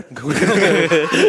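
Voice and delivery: sudden high laugh; burst of laughter. Real, not acted. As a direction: laugh out loud. Laughing at own story.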